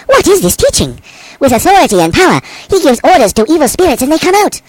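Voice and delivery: high-pitched, astonished exclamation; an amazed crowd marvelling to each other. A narrator's reading. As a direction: exclaim in wonder, voice lifted high. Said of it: "All the people were amazed".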